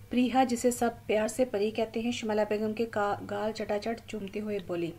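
ordinary delivery

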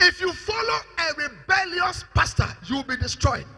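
"rebellious pastor" pitched high and projected; emphatic warning. Real, not acted. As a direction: drive home "destroyed" with full force. Warning repeated with more force.

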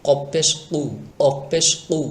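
কু with a heavy k from far back; thick, knocked কফ. Shown as a demonstration. Teacher shows a thick কু with slight knock. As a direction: tap কু slightly at the back of the throat. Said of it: "একটু টক্কর লাগবে সামান্য পরিমাণ".

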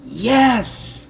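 high, jubilant confirmation; triumphant voice. Retold or imitated. God confirms his understanding, triumphant high yes. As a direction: let yes ring out high. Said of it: "came that voice".

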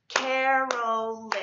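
Carolyn drawn out slowly in three even beats; syllable by syllable. Shown as a demonstration. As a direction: split Carolyn slowly into Ca-ro-lyn. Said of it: "why don't we try clapping that out".

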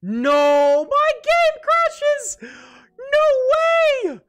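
high, loud cry of despair; anguished shout. Real, not acted. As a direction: wail as the game crashes. Game crashes mid-fight.

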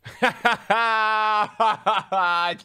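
high, loud burst of laughter. Real, not acted. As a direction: laugh out hard. Big laugh.

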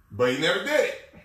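ordinary talk

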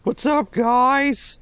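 high-pitched, hammed-up hello; mock chummy greeting. Player voicing Gabriel interrupting the club, chummy. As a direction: butt in with a high, overfriendly voice. Player voices character butting in.

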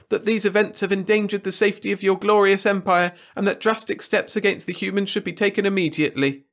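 high, anxious courtier voice; Wirt's whiny voice. A narrator's reading. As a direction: plead nervously with the king. Wirt reports.

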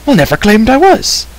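high, protesting shout; shouting back. A narrator's reading. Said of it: "the stranger shouted back".

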